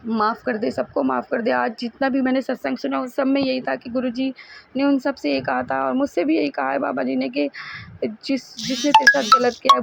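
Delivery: ordinary talk, nothing marked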